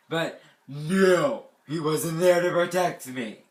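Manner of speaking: loud, drawn-out complaint; indignant lament. Real, not acted. Camper whose dad left early, loud indignant lament. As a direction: stretch it out loudly in mock outrage.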